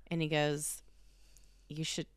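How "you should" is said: put-on flat, matter-of-fact sass from a small child; a toddler's deadpan comeback. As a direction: say it dead flat but cheeky. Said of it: "like, matter of fact, but with sass".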